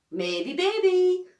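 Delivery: high, loud, drawn-out singsong; teasing grin. A narrator's reading. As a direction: tease a friend, stretching it out with a grin. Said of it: "grins Be-, Beaky, nu-, nudging me".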